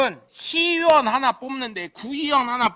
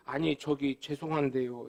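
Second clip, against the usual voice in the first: softer, timid little apology; meek apology; 8 semitones lower, 8 dB quieter than usual. Retold or imitated. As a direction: back down like a scolded official. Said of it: "이러고". (기죽은 목소리로 사과하는 구의원)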